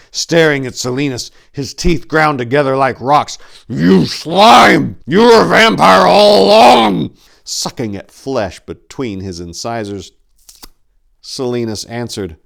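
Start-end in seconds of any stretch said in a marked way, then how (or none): 3.7-7.1 furious, loud and high accusing yell; hateful shout. A narrator's reading. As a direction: spit the words out in hatred. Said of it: "Hate filled his eyes".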